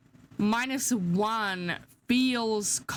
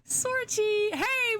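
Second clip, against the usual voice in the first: playful high greeting; 12 semitones higher, much slower than usual. Real, not acted.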